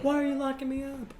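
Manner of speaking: high, pleading little fairy voice; high squeaky fae voice. Pretend.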